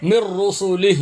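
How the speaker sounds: "مر رسلہ" stopped on a breathed h; sounded final h. Shown as a demonstration. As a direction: end "مر رسلہ" as mirr-rusulih. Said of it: "ہا کی آواز آئے گی".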